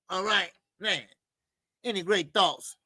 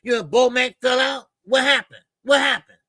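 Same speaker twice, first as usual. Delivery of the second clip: louder and higher nosy gossip voice; loud prying mock curiosity; 3 semitones higher, 5 dB louder than usual. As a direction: pester them with fake concern, building. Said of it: "now you're gonna say".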